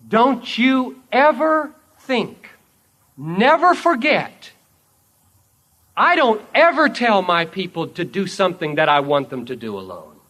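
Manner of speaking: emphatic lesson, spaced out word by word; forceful preaching. Acted. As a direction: drive the lesson home from the pulpit.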